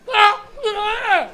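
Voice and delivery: high, very loud angry squawk; furious wordless cartoon honking; Pingu getting mad. Imitating the penguin when mad, loud angry squawks. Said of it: "Remember when he'd get mad".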